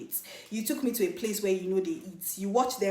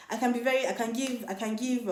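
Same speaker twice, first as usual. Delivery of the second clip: ordinary delivery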